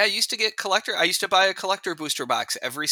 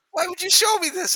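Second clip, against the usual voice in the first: exasperated, higher; 12 semitones higher than usual. Real, not acted. Groaning at the cards, repeated.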